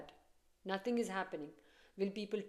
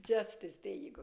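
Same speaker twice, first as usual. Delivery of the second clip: normal talking voice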